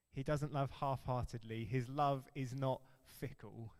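ordinary talk, nothing marked

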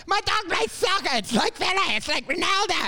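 high-pitched excited bragging voice; hammed-up high brag about the dog; viral video dog owner. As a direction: gush like an overexcited YouTube clip. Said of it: "someone that they get on a Euro, uh, a YouTube viral video".

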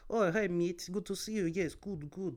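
high, bright Eastern European accent imitation; Lithuanian accent. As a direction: greet a coworker in a put-on Lithuanian accent. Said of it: "She's like".

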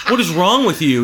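loud, laughing disbelief at someone clueless; half-laughing rant; what is wrong with you, loud and incredulous; exasperated yell. Retold or imitated. Ranting at a clueless poster.

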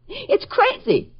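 high, slow, tearful cry; distraught wail. Acted. Bookstore owner lamenting a book's demand, distraught. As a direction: draw it out in exasperated despair.

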